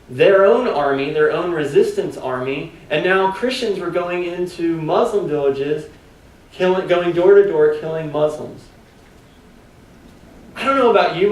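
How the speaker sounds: ordinary delivery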